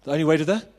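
"the only way to the" held open on "the", waiting for the crowd; crowd call-and-response prompt. Shown as a demonstration. Preacher prompting the congregation to finish, rising call. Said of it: "say it".

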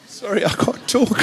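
choked up, high, with a teary laugh; can't get words out for emotion; real overwhelmed, breaking laugh. Real, not acted. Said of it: "I'm overwhelmed".

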